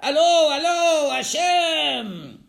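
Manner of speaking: loud, high drawn-out cry to God; acted desperate call to Hashem. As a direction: shout it long, as if flagging down rescue. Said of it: "And what did he call?".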